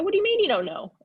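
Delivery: can't believe what she just heard; incredulous. Retold or imitated. Recruit questioning the coach about playing time, incredulous.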